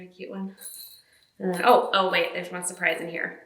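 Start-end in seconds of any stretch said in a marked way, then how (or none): none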